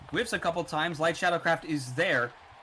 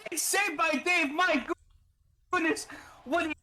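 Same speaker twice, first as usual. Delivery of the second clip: high excited commentary; excited play-by-play; 11 semitones higher than usual. Real, not acted. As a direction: call the save with rising excitement. Live hockey play-by-play.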